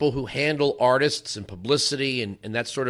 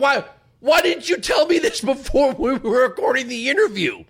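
much higher, loud mock cry of dismay; exasperated shout; 10 semitones higher, 4 dB louder than usual. Host to [name] in the hallway, exasperated shout. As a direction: wail it out in comic frustration.

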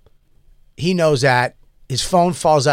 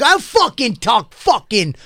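retold furious cursing; 8 semitones higher than usual. Stranger in the subway screaming at him, mock furious high rant. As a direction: act out the guy screaming insults, pitch climbing. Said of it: "he's like".

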